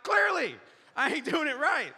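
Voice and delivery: high, loud exasperated yell; hammed-up sarcastic shout back; mock angry. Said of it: "I'm so angry".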